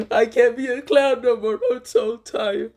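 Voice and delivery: high, hammed-up wail; mock crying. Shikamaru crying after passing, high mock wail. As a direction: wail it way up high like a fake sob. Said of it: "and now was crying".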